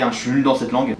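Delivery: usual speaking voice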